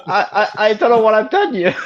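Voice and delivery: high, loud, through real laughter; helplessly amused, pitch way up; laughing. Real, not acted. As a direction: stammer it out while cracking up. Helpless laughter after own story.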